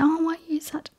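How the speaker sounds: hushed high imitation of pitying people; mock concerned stranger. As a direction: parody a nosy stranger's fake pity. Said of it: "and say".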